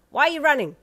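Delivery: loud, high, pushy boasting; a brash brawler pinning someone down; loud boastful demand. A narrator's reading. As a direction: demand it, proud of your strength. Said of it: "the voice from your back implored".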